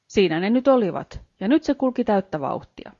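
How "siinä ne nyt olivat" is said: plain reading voice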